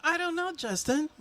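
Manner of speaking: sing-song call and response; playful call-out. Pretend. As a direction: pass the question down the line like a game. Call-and-response chant with the crowd.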